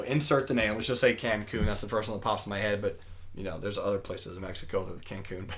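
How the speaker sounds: low, soft throwaway aside; quiet aside. Real, not acted. Storyteller excusing his made-up place name, quiet aside. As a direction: drop the voice for a side note.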